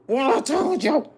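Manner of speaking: high, slow, stretched gloating cry; excited told you so. Real, not acted. Reader crowing to viewers that her prediction came true, excited. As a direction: crow it out, vindicated. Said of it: "I'm sorry, let me calm down".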